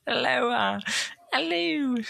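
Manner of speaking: very high squawking parrot. Acted. The parrot greeting Stangler, high squawk. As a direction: screech it like a bird. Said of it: "That's what he sounded like".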